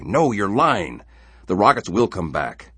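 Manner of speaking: father wanting to shout at daughter; crying out. Acted. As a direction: shout it in disbelief.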